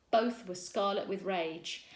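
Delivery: plain reading voice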